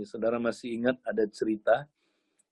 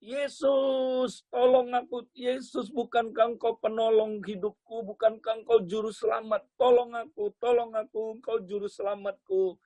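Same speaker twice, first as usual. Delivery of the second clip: high-pitched frantic pleading, acted; teriakan minta tolong; 8 semitones higher than usual. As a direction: cry out for rescue in a high, desperate voice. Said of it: "Kemudian anak muda ini teriak". (memohon tolong dengan suara tinggi dan panik)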